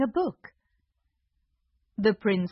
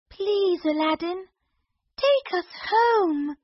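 high, slow begging voice; pleading princess; 10 semitones higher than usual. Acted. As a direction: beg to go home.

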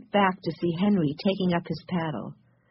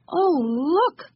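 very high, drawn-out delighted exclamation; happy cry; 12 semitones higher than usual. A narrator's reading. Benny spotting the goods in the store, happy cry. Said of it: "cried Benny happily".

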